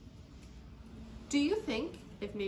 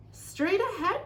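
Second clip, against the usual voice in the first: high, loud, excited call; excited cry; 9 semitones higher, 10 dB louder, slower than usual. A narrator's reading. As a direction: cry out excitedly, pitch jumping up. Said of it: "the frogs cried out excitedly".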